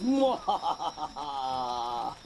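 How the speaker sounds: low villainous cackle; evil laugh. Acted. Hogula urges his bats to dance, low cackle. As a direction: cackle over the bats like a count.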